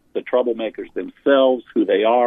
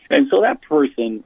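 ordinary talk, nothing marked

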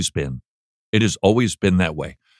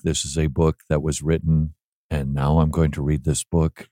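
low, soft, lifeless monotone; mock-boring audiobook narration, low and soft; dull droning read; 8 semitones lower, 3 dB quieter than usual. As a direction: drone it out like you're putting them to sleep. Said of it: "terrible fear they're gonna want me to read it".

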